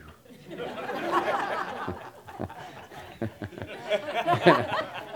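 long high breathy laugh; breathless laugh. Real, not acted. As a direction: burst into silent, wheezing laughter. Laughs at the stranger's question.

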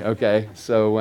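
ordinary talk

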